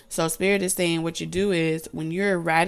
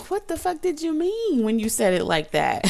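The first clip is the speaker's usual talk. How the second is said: incredulous retort; 8 semitones higher than usual. Retold or imitated. Retorting to contradictory people.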